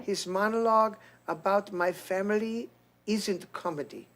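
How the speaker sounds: mock First Lady accent, voice lightened; Melania accent. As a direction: read it in a soft Eastern European accent. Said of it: "can you do her voice".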